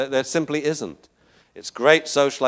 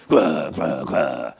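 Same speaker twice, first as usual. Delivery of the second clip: low repeated growls; imitated dog growl; 10 semitones lower, much slower than usual. Whip dominating the puppies, deep mock growling. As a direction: growl deep in the throat, three times.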